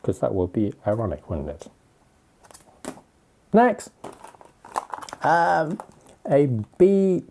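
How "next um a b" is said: excited. Real, not acted. Moving to next item.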